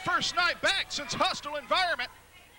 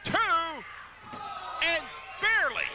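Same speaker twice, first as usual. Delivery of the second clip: normal talking voice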